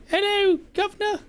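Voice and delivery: high falsetto British "Hello, governor"; hammed-up British lady greeting; bad female Cockney. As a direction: camp it up as an English lady. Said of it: "that's horrible".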